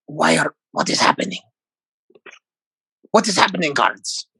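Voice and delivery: a lost prisoner blinking at freedom; confused prisoner creature. Acted. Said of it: "my rat voice is very, very different".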